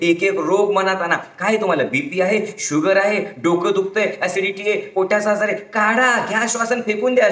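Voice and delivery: ordinary talk, nothing marked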